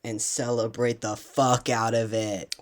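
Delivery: ordinary talk, nothing marked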